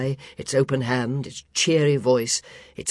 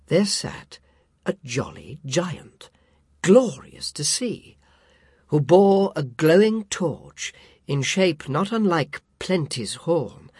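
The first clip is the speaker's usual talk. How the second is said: ordinary delivery, nothing marked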